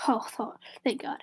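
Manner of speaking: whispered, shaky relief; relieved whisper. Real, not acted. Streamer almost clicking X out, whispering relief. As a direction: breathe out the thanks in a whisper.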